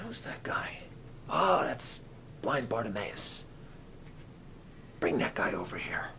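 hushed, near-whispered exchange; quiet aside. Acted. Jesus quietly asking disciples about the blind man, hushed.

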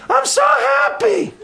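put-on high, slowed, shouted old-man joy; overjoyed old man. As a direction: squeal it out like a delighted old man. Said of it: "That's the way he talks".

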